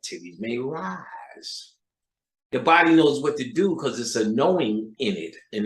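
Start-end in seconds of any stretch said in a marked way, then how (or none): 0.0-1.8 slow suggestive. Pretend.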